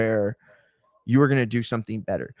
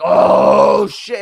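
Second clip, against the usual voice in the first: breathy burst then high gasp; a crowd caught off guard; mock stunned crowd; 6 semitones higher, 10 dB louder than usual. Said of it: "everybody's like".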